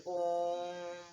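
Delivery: ओम held on one steady note; long drawn-out ओम. Shown as a demonstration. Teacher demonstrating a prolonged vowel, long steady ओम. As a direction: chant ओम, sustaining the vowel. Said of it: "लंबे समय तक किया है ना".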